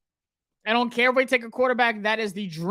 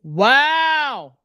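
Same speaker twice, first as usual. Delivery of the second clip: surprised wow; 6 semitones higher, 6 dB louder than usual. Real, not acted. Reacting to a rival pick.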